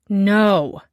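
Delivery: No. punched hard and loud; emphatic no. Acted. Co-owner flatly refusing the chef, hard emphatic No. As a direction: slam the word No down. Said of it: "put a hell a lot of emphasis on the word".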